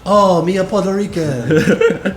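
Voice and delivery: very high, loud and slow put-on voice; hammed-up comic character voice; mock character line. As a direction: drawl it out in a goofy high voice. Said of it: "stealing that from Thirty Rock".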